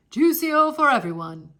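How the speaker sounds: hearty host offering drinks to all; booming host voice; proud father. Acted.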